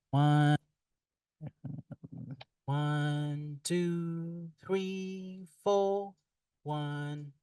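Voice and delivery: comb-filtered metallic robot sound; phasey, hollow processed voice. Shown as a demonstration. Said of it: "you're going to have that metallic voice".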